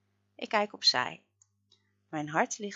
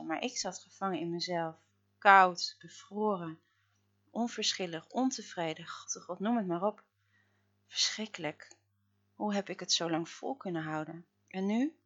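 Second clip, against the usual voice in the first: usual speaking voice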